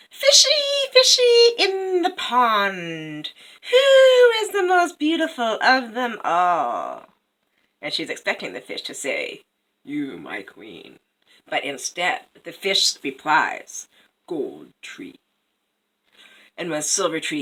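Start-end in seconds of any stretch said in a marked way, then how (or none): 0.1-7.1 high, loud, drawn-out chant; sing-song call to the fish, slowly; queen's incantation. Acted. Queen calls to the magic fish. 9.8-11.0 slow, low and soft; a hint of a humble, low-voiced fish; lightly voiced fish. Imagined flattering answer of fish. 14.3-15.2 low, soft, whispery fish voice; hushed deep oracle fish; fish's reply. Acted. Fish answers the queen.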